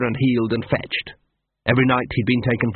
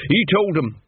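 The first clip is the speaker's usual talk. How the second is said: higher, a touch of fearful fascination; fearful awe; 7 semitones higher than usual. A narrator's reading. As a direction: tell it half scared, half in awe. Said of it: "a mixture of fear and fascination".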